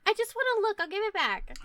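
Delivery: protesting character. Acted. Adventurer defends picking up the coin, high-pitched protest. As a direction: insist in a high voice that it's harmless.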